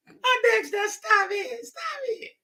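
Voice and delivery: very high falsetto woman's protest; coy woman. Retold or imitated. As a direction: squeal coyly for him to stop. Woman fending off playfully.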